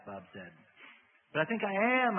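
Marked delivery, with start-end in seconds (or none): 1.3-2.2 high, loud wail. A narrator's reading. Woman answers Bob in distress, wailing. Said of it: "I wailed".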